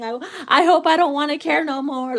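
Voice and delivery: hammed-up lovesick wish; a dramatic mock cry of giving up; mock heartbroken plea. Retold inner voice, mock plea.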